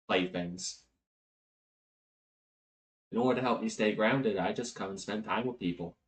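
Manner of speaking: soft, measured, earnest speech; hushed, grave immortal confiding a lesson; solemn archangel. Acted. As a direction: explain a sacred duty gently, with pauses.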